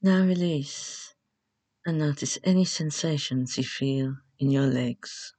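measured relaxation instructions with long pauses; guided meditation. Acted.

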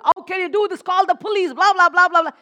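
loud, high imitation of an angry street crowd; mock screaming crowd. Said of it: "people screaming on the road".

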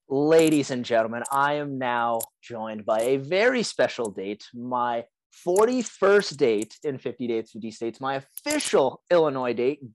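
slow, loud show-host introduction; announcer voice. Acted. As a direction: announce the guest grandly.

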